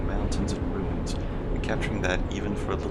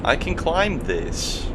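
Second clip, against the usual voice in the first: slow, higher, willing it; determined self-pep; 7 semitones higher, 4 dB louder, much slower than usual. Real, not acted. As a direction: pump yourself up slowly. Psyching himself up to climb.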